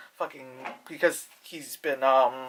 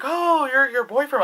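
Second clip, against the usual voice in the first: high, loud retold exclamation; drawn-out high "oh" in imitation; mock exclamation; 9 semitones higher, 6 dB louder than usual. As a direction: act out her reaction, pitched up and loud. Retelling her reaction in acted quote.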